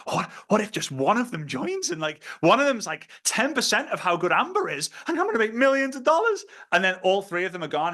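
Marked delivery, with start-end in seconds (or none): 0.1-6.4 daydreaming. Pretend. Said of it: "and you're thinking".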